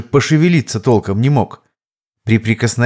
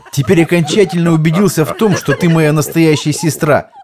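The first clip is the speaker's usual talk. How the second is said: laughing; 6 semitones higher than usual. Acted. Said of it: "обиделся и прокричал мне сквозь гогот".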